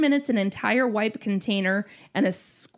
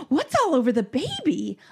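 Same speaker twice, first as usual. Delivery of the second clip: higher-pitched mock horror; gasping alarm; 4 semitones higher than usual. Dad's girlfriend spotting the mess on baby, mock alarm. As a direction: imitate an appalled onlooker's question. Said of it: "gasps and says".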